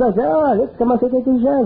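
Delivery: high quick mock praise; teasing inner voice. The inner voice mock-praising Jean-Paul, high and quick. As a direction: tease him with fast high praise. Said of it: "tu dis".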